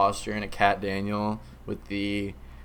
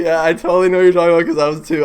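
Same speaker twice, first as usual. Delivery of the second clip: ordinary talk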